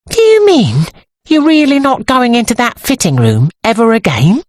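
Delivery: high-pitched disbelieving question; astonished, high-voiced incredulity; incredulous. A narrator's reading. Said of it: "said Sybil incredulously".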